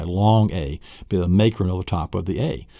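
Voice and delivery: ordinary talk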